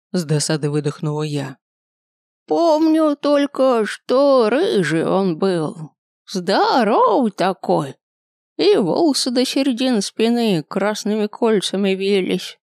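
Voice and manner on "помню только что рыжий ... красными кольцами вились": high, creaky, slow ancient grandpa; very old man. A narrator's reading. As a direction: groan it out like a frail old man.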